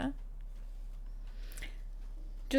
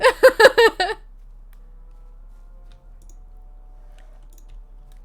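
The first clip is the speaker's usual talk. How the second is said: loud high laughter; 12 semitones higher, 12 dB louder than usual. Real, not acted.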